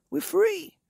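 high, loud little ones' cheer; excited cry. A narrator's reading. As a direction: cry out in high-pitched joy. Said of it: "cried the two little ones".

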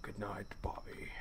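breathy ghostly whisper of the name; eerie whisper. Acted. A ghost whispering good night to Bobby, eerie whisper. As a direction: hush it like a ghost at the bedside. Creepy whisper in horror game.

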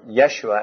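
Yeshua said with an initial Y, "yeh-SHOO-uh"; Hebrew pronunciation. Shown as a demonstration.

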